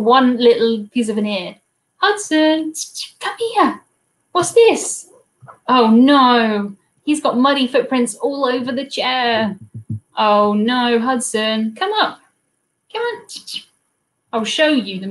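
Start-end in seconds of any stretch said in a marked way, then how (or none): 2.0-5.1 high-pitched talk to a pet; calling a dog. Real, not acted. Streamer to Hudson about muddy paws, high dog talk. 11.7-13.3 very high little pet call; coaxing a dog. Real, not acted. Streamer coaxing Hudson up on camera, very high call.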